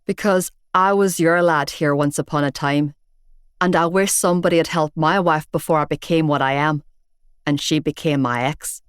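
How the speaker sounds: a hint of a gruff Belfast man; Belfast accent. Big bearded man telling off Shane, in a Belfast accent. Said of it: "distinctive Belfast accent".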